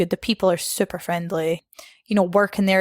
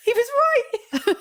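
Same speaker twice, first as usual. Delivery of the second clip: very high, drawn-out squeal, about to laugh; mock panicked realisation; slower than usual. Worker reading an earthquake contract, retold as squealing mock horror.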